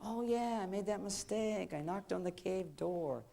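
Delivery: softly spoken, drawn-out admission of a mistake; sheepish realization. Acted. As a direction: say it quietly to yourself, slowing down. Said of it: "you'll be all upset. You'll go back and you go".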